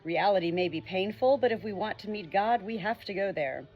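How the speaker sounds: ordinary delivery, nothing marked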